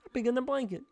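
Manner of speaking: high frog puppet voice; punchline in the frog voice. Pretend. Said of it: "I get stuck in the voice".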